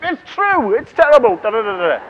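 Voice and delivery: high, hammed-up cry of dismay; mock panic. Speaker spots another sleepy listener, mock panic.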